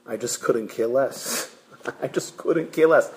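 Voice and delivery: low, soft shrug of a voice; indifferent fourth child. Acted. Fourth child answering at the Seder, indifferent. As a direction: toss it off quietly, not caring. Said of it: "The fourth one comes to the Seder".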